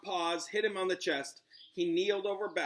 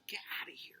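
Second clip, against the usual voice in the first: unvoiced hush to an animal; quick hushed shoo; whispered aside; 9 dB quieter, much faster than usual. Real, not acted. Owner shoos animal away in a whisper.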